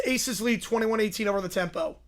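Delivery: ordinary delivery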